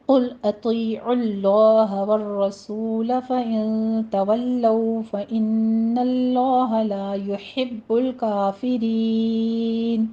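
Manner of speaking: slow melodic tajweed chant; measured Quranic recitation. Shown as a demonstration. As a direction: draw each word out like a reciter. Recites next verse.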